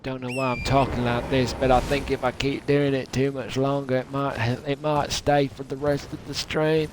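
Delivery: thick put-on Southern US accent; deep southern accent. Streamer blaming the catacombs, deep southern accent. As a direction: talk like a mock southerner. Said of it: "I had a deep southern accent".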